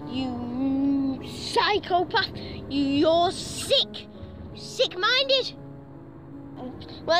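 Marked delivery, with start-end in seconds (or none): none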